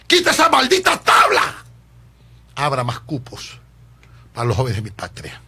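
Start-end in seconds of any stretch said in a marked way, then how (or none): 0.1-1.6 much higher, loud "Quita esa maldita tabla" with a rise; escalating outraged demand; rising shout. Real, not acted. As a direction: push it even higher.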